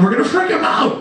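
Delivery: high, excited shout; acted gleeful rallying. Satan leading a field trip to heaven, gleeful rallying cry. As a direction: whip up the gang for mischief.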